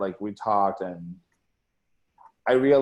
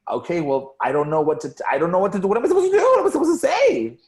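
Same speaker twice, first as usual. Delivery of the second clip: building from raised voice to very high, loud yell; mock panicked outburst; 9 semitones higher, 9 dB louder, faster than usual. Retelling not knowing what to say to upset partner, panicked yelling. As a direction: escalate into a hammed-up, high-pitched freak-out. Acts out overwhelmed panic at news.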